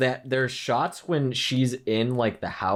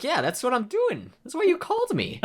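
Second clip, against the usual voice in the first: put-on much higher-pitched kid voice; mock exasperated retort; 14 semitones higher than usual. Kid answering the bartender who called him, mock exasperated.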